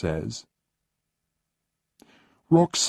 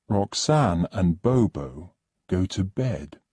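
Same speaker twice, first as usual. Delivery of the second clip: ordinary delivery, nothing marked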